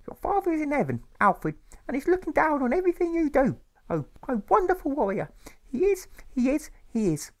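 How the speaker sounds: very high, loud, sing-song mother insisting; a grieving mother clinging to hope; tearful mother. A narrator's reading. Mother reassures her son about his father, high and loud.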